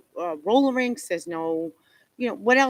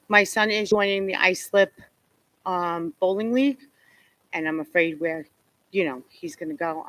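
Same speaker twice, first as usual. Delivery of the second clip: usual speaking voice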